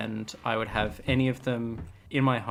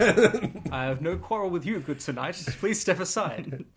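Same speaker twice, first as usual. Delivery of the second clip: higher, formal chivalrous plea; polite knight voice; 5 semitones higher than usual. Acted. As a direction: ask courteously like a knight. Voicing polite traveler.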